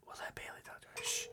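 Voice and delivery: barely audible whispered agreement; voiceless, very soft aside; hushed whisper. Real, not acted. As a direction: whisper under the ringing phone. Hushed while phone rings.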